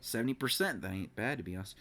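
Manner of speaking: soft, low calm voice. Real, not acted. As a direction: settle down quietly. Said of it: "I should cool the fuck down and talk normally".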